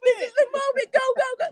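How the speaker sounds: squealed high chant of go go go; playful echo of the hype; high excited urging. Retold or imitated. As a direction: cheer her on in a high, thrilled voice.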